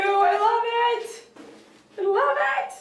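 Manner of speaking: high, loud burst of delight; squealing I love it. Real, not acted. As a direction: gush over the gift. Gift opening, delighted.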